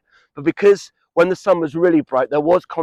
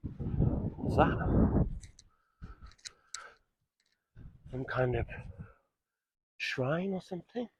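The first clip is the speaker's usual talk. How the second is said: hushed; 16 dB quieter, slower than usual. Real, not acted. Hushed wonder at a hidden shrine.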